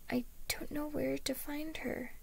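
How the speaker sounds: put-on high, soft lost-child voice; whimpering. A narrator's reading. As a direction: whimper like a lost little boy. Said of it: "the boy whimpered".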